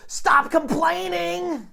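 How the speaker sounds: high, slow, loud yell; yelled order. Retold or imitated. Gluttonous lady shouting him down, slow loud yell. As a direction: drag out a scolding shout. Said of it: "that's what she says".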